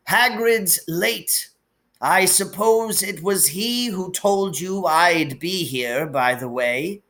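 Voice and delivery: put-on gentle sorrowful old wizard; wise old headmaster, lightly voiced. A narrator's reading. Dumbledore figure held.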